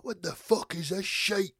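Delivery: a lightly voiced grumpy Scottish dad; dad waving off activists on the telly, mild gruff grumble. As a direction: grumble like a fed-up father wanting the football. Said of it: "And he's like".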